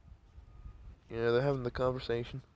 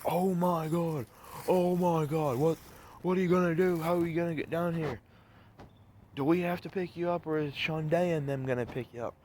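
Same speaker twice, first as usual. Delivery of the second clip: freaking out; 6 semitones higher than usual. Retold or imitated. Nanny hears the car's on fire, imitated freaking out. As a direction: imitate a worried nanny fretting in a higher voice. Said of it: "nanny's freaking out".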